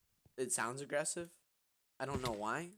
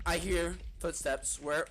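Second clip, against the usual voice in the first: alarmed; 6 semitones higher, 9 dB louder, slower than usual. Real, not acted. Alarmed in game.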